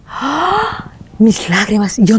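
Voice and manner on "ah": agudo grito ahogado de asombro; jadeo de conmoción. Retold or imitated. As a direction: toma aire de golpe, conmovida. Reacciona conmovida al recordar la noticia. (exclamación aguda de sorpresa dolida)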